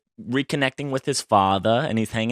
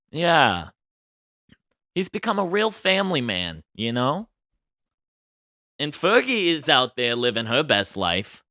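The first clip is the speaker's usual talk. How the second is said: ordinary talk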